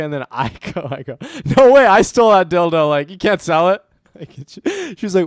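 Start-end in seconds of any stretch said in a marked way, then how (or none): 1.6-3.8 loud, raised protest over a stolen prize; indignant protest. Retold or imitated. To Riley Reid, refusing to let her sell the dildo, loud indignant protest. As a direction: insist it's yours, loud and a bit higher.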